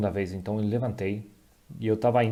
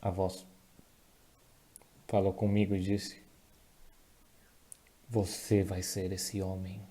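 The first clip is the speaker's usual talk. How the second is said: real emotion, soft and halting; choked up; 4 dB quieter than usual. Real, not acted. Preacher recalling God's answer on the plane, choked up. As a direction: struggle to get the words out, with long pauses. Breaks off, long pauses, apologises.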